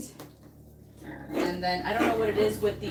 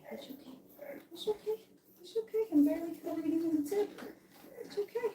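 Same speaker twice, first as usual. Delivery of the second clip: high soft cooing reassurance; 9 semitones higher, 3 dB quieter, faster than usual. Real, not acted. Calming the puppy during nail trim. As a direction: keep the puppy calm, gently.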